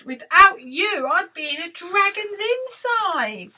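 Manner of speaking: high, emphatic relief; grateful cry. Acted. Witch thanks friends who saved her, grateful cry. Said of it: "the grateful witch cried".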